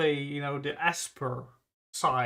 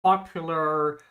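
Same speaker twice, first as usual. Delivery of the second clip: ordinary delivery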